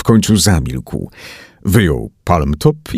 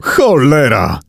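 higher-pitched exclamation; moderately raised cry of frustration; shouted curse; 5 semitones higher, slower than usual. A narrator's reading. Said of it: "krzyknął John".

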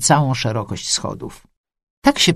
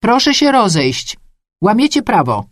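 raised, high-pitched official command; loud authoritative warning; commanding order; 7 semitones higher, 4 dB louder than usual. A narrator's reading. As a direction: order the crowd to break up. Official orders crowd to disperse.

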